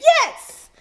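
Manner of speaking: a thrilled little cheer; excited yes. Real, not acted. Cook cheering over her pot, high excited yelp. Hyped about adding veggies.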